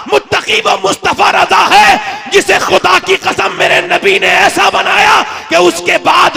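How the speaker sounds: high, loud, stretched-out oratory; impassioned, drawn-out preacher's shout; full-force preaching. Real, not acted. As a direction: thunder it to the whole gathering.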